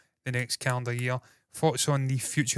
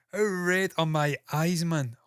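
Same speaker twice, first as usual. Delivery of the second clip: ordinary talk, nothing marked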